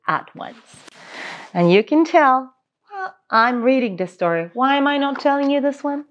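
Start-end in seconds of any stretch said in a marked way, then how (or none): none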